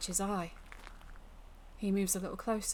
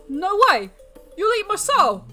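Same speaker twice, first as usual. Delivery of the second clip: loud, high, panicked refusal; frightened, high-pitched protest; terrified; 10 semitones higher, 11 dB louder than usual. Acted. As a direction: cry out in fear of the fiend. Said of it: "He shakes with fear".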